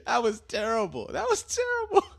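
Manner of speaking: laughing through the words, climbing into a squeak; high-pitched, cracking up as you speak; helpless laughter. Real, not acted. Host mocking his own failed accent, laughing hard.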